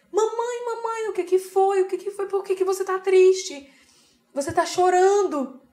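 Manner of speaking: put-on high, slow child's voice, worried; a small daughter asking why mum cries; worried little girl. Mother voicing her small daughter. (voz de criança preocupada)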